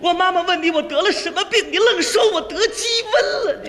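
high wailing complaint; hammed-up crying, rising in indignation; tearful wife. Wife complains about husband's lie, tearful. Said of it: "就别哭了".

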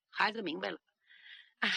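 usual speaking voice

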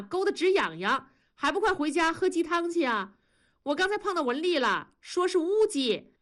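high-pitched, teasing housewife calling through the door; chatty neighbour's wife. A narrator's reading. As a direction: play a nosy neighbour, pitched well up. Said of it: "庄嫂在外面又说".

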